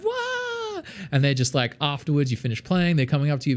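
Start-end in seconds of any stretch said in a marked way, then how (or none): 0.0-0.8 very high excited squeal; crowd cheer. Retold or imitated. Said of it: "and everyone's just like".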